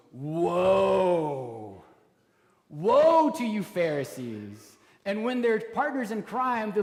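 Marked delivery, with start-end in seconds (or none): 0.1-4.7 booming, slow cry of woe; loud rebuke. Retold or imitated. Jesus shouting woe at the Pharisees at dinner. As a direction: thunder it at the Pharisees. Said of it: "he turns it on him and he goes".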